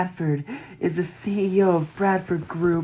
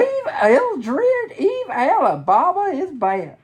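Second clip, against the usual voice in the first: much higher, playful pitch; high squeaky voice; 10 semitones higher, 4 dB louder, slower than usual. Acted. High detective voice.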